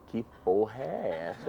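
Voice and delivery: slow, low baffled exclamation; baffled disbelief. Retold or imitated. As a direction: stare at your own work in disbelief. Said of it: "eu falo assim".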